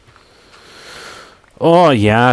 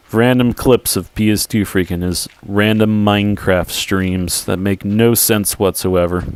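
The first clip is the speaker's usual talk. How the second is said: ordinary talk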